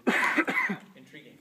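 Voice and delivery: ordinary talk, nothing marked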